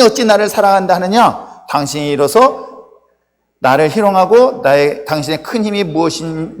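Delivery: loud, measured congregational recitation; scripture reading. A narrator's reading. Preacher leads congregation through Delilah's plea, reading aloud. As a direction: proclaim the verses for all to follow.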